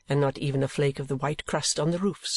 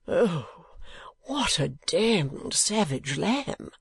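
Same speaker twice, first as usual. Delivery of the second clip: slow, slightly raised lament; lightly voiced wounded husband, drawing words out; a touch of foppish dismay; mild theatrical cry; 3 semitones higher, much slower than usual. A narrator's reading. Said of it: "cried Mister Mantalini".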